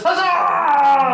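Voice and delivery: high, loud, long cheer; raucous celebratory holler; crowd huzzah. Acted. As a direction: shout along with the whole hall. Everyone cheers.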